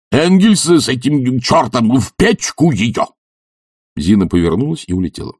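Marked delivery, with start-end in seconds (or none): 0.1-3.1 high, emphatic angry command; furious order. Acted. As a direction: order it burned, indignant and raised in pitch. Furious professor orders book burned.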